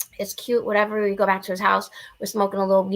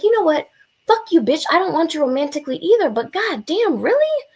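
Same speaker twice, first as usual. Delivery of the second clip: much higher and louder, furious mock telling-off; angry outburst; 10 semitones higher, 5 dB louder than usual. Retelling how she yelled back at the guy who rejected her, loud and angry. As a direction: blow up at him, peaking on really.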